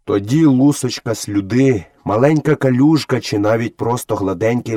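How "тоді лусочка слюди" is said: ordinary delivery, nothing marked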